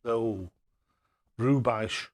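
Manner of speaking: normal talking voice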